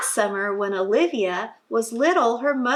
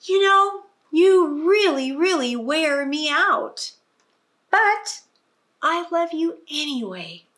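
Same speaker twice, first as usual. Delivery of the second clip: tired loving mother; 7 semitones higher, 4 dB louder than usual. A narrator's reading. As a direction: lean into the sigh, then melt. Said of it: "gives her a kiss and says".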